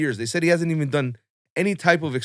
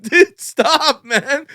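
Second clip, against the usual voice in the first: high, loud, slow blubbering plea; mock bawling plea; 13 semitones higher, 9 dB louder, much slower than usual. To the crying roommate at five in the morning, a mock bawling high plea. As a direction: beg him to stop through hammed-up sobs. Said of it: "We're crying our eyes out".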